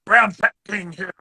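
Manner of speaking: booming hammed-up character impression; Liver King. Imitating Mike in the ice bath, hammed-up impression. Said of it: "doing his impression of the Liver".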